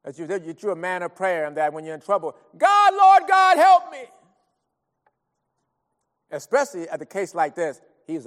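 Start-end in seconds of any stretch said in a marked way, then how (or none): 2.6-4.0 loud, high, drawn-out plea; acted full-voice cry for divine help; crying out to God. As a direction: shout a desperate prayer for the whole house to hear. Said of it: "hear you cry out to God".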